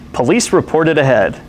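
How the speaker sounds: ordinary talk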